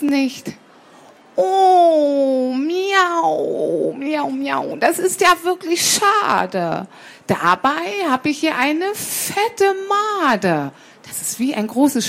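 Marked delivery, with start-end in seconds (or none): 1.4-10.7 high, mewing cat voice; coaxing cat. Acted. Cat tempts sparrow with a fat maggot, high coaxing. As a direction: draw out the meows in mock regret, then tempt.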